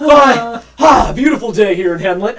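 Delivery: loud, high, cheery hail; jovial captain, big and loud; hearty greeting. Acted. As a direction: boom a breezy hello to the town.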